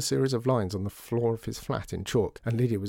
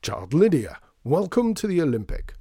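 big, higher shopkeeper's welcome; forced cheer; 7 semitones higher, 5 dB louder than usual. A narrator's reading. Shopkeeper welcoming Lydia to his store, forced cheer. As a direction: greet grandly, trying to sound delighted. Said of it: "trying to be excited and cheerful".

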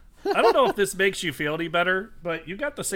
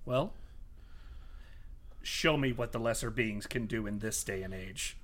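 low, soft villain challenge; quiet condescension, dropping low; condescending villain; 8 semitones lower, 11 dB quieter than usual. Acted. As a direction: coolly invite the lesser foes to try. Villain invites party to attack.

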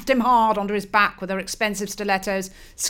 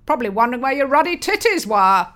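1 loud mocking father; 4 semitones higher, 7 dB louder, faster than usual.

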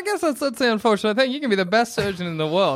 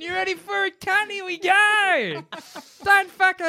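much higher, hammed-up holler; yelling surgeon; 12 semitones higher than usual. Imagining an aggressive surgeon starting the operation, mock yelling. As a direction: drag out the mock battle cry, high and strained. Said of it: "If he's yelling like".

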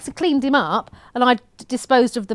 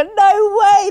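high, emphatic disbelief; real astonished exclamation; amazed disbelief; 8 semitones higher, 4 dB louder than usual. Real, not acted. Podcast host reacting to listener's toddler story, amazed disbelief.

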